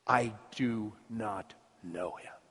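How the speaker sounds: low, soft, slow denial; hushed denial. Acted. Peter denying Jesus to the servant girl, hushed and slow. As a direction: space the words out slowly, very quietly.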